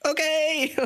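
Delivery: high awkward okay; a startled nurse's high okay. Retold or imitated. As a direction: say okay like a stunned, high-pitched listener. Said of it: "Or was she like".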